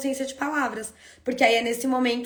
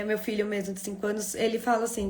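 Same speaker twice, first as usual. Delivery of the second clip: ordinary talk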